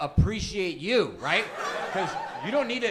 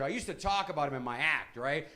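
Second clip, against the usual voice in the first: ordinary talk, nothing marked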